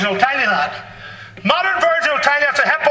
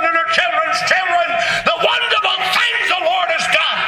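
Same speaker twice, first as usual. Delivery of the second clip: rising into a high preaching shout; preaching at full force; 3 semitones higher than usual. Acted. Preacher rousing his congregation, climbing into a shout. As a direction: lift the congregation, voice climbing and ringing.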